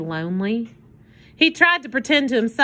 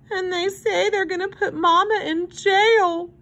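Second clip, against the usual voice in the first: put-on high, tearful little girl voice; weeping child; 9 semitones higher than usual. A narrator's reading. Girl tells hobo Mama faces jail, weeping. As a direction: wail it plaintively through tears. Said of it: "Susie wept".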